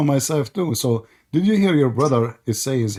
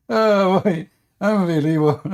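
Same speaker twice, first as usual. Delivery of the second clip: high, loud exclamation on the edge of laughter; amused disbelief; 6 semitones higher, 3 dB louder than usual. Real, not acted. Host marvelling at the exit, cracking up.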